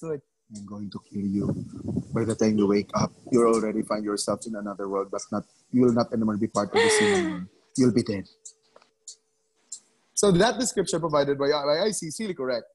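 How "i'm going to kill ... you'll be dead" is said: lower, teasing mock threat; mock menacing whisper. Teacher jokingly imitating the student's creepy whisper. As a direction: play a joking killer murmuring in an ear. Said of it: "Icy was trying to whisper something in your ears".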